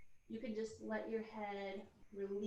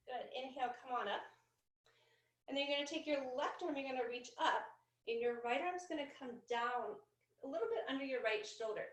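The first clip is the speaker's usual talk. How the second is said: normal talking voice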